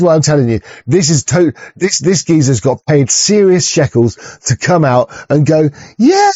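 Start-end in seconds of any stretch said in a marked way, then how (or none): 6.0-6.3 mock presenter. Mocking paid presenter.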